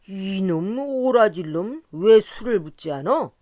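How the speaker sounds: put-on a drunk scolding the serving boy; drunken scolding. A narrator's reading. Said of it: "야단을 쳤다".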